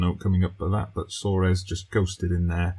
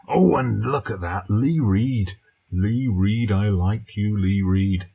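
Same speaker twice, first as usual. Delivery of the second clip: excited cheer; 2 semitones higher, 5 dB louder, slower than usual. Real, not acted. Manager cheering his striker's goal, loud and delighted.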